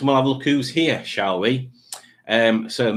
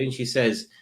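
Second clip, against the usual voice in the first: normal talking voice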